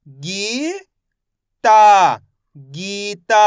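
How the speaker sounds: गी held, ता loud, then गीता joined; drawn-out syllables building गीता; direct syllable sounding. Shown as a demonstration. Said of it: "direct मात्रा का उच्चारण करके पढ़ेंगे".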